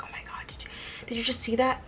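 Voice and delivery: excited unvoiced whisper in a dark theater; movie whisper. Leaning over to a friend mid-movie, whispering excitedly. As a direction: lean over and whisper so only the friend hears. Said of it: "I lean over and be like".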